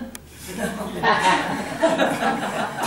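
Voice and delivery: laughing out loud. Real, not acted. Narrator laughs at own joke.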